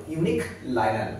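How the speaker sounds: slow "ইউনিক", slight break, "লাইনাল"; piece-by-piece pronunciation. Shown as a demonstration. Teacher joins the words for students, slowly, piece by piece. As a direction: say "ইউনিক লাইনাল" slowly as two separate parts. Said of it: "উচ্চারণটা কেটে কেটে করতে হবে".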